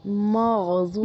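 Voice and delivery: مغضوب slow, ending on ب with no ی; correcting pronunciation. Shown as a demonstration. Tutor correcting her student's مغضوبی to مغضوب. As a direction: say مغضوب slowly, stopping on ب. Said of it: "مغضوبی".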